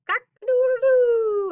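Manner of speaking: high, loud, slow crowing call; rooster crow. Acted. Teacher reading the farm book, a loud rooster crow.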